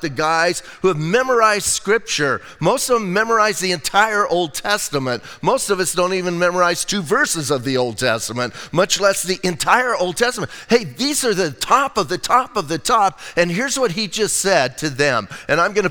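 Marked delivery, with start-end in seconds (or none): none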